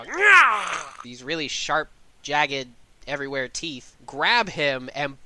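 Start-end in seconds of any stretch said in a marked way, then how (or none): 0.0-1.0 high, loud creature shriek; sudden toothy river-monster snarl-screech. Acted. Green woman pops out of the water, high screech. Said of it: "pop out of the water, go".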